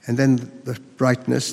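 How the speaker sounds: ordinary delivery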